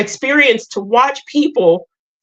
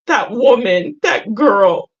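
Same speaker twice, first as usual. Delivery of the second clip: crying; 2 semitones higher, slower than usual. Real, not acted. Crying to her viewers about her past self. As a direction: voice cracking as she cries. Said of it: "I'm sorry I'm crying".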